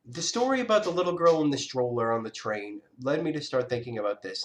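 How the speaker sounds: ordinary talk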